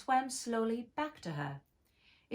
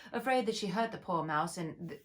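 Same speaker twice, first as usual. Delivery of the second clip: ordinary delivery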